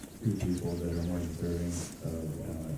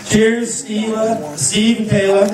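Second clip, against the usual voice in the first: wedding toast speech; 11 semitones higher, 16 dB louder, slower than usual. Real, not acted. MC toasting, raising glasses.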